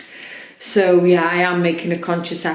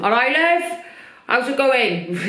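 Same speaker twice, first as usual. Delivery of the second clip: put-on a light, higher-pitched Welsh accent as her dad; imitating dad's Welsh accent; 6 semitones higher than usual. Dad greeting her on the Sunday phone call, light Welsh accent. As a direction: lift the voice into a gentle Welsh greeting. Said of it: "in his Welsh accent".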